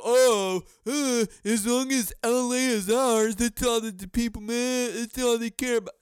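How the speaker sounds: lightly voiced smug Lakers player, mocking. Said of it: "They're like".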